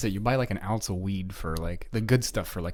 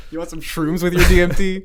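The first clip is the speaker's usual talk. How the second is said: goofy dealer; 7 semitones higher, 8 dB louder than usual. Pretend. Mocking biker dealer.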